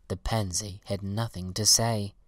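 ordinary delivery

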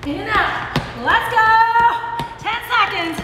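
panting mid-workout; breathless coaching cues; out of breath. Real, not acted. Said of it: "I'm sorry about my panting".

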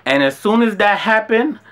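normal talking voice

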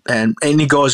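ordinary delivery

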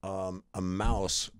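low, halting bad-news delivery; solemn dad. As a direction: break it gravely, with long pauses between phrases. Dad jokingly breaks grave news.

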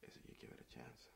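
low, soft pleading whisper. Acted. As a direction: beg him quietly.

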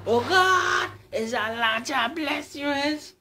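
high terrified cry; horrified shout. Acted. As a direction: scream the question in terror. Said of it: "The horrified man shouted".

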